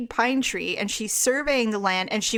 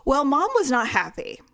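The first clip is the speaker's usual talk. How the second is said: normal talking voice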